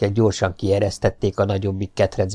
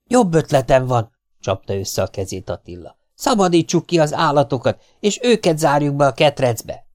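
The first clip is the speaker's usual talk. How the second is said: put-on eager older boy; 6 semitones higher than usual. Attila has an idea.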